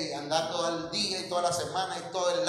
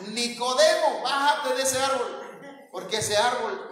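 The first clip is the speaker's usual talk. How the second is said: laughing; 7 semitones higher, 4 dB louder than usual. Retold or imitated. Lord tells man to descend.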